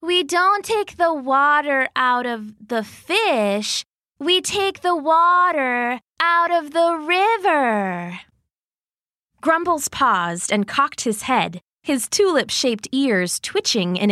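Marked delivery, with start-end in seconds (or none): 0.0-8.2 higher, slow triumphant bear voice; a hint of a bear proudly unveiling her plan; Goldie the bear. Goldie reveals her plan to Grumbles, slow and higher. Goldie announces the big idea.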